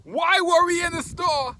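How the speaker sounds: high, loud incredulous rant; exasperated plea. Real, not acted. Vlogger begging viewers to explain the toilet tissue rush, exasperated. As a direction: plead for an answer, pitch climbing in disbelief. Mock pleading over toilet-paper hoarding.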